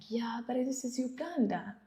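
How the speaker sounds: whispered private thought; inner thought whispered. Retold or imitated. The host whispering what she thought about the guest. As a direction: breathe it out as a secret thought. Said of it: "In my head, I'm like".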